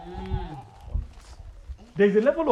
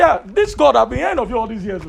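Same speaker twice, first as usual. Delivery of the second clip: raised, louder awestruck Job; Job humbled; 4 semitones higher, 5 dB louder than usual. Retold or imitated. Job humbly answering God.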